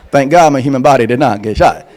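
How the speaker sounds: normal talking voice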